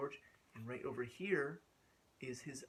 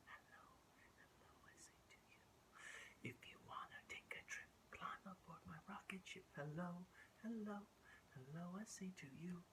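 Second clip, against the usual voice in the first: whispered song; 4 semitones higher, 15 dB quieter than usual. Acted. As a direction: sing it in a whisper.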